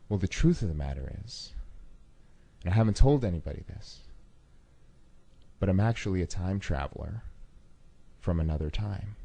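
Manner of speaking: low-key, straight-faced confession; deadpan. Retold or imitated. Volunteer confides he is a time traveler, deadpan. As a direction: confess it with no expression at all. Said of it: "with no facial expression at all".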